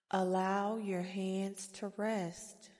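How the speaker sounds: ordinary talk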